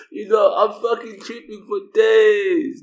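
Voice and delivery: slow, high, loud shout of distress; upset shout. Real, not acted. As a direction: yell it out, drawn out. Telling how upset he was.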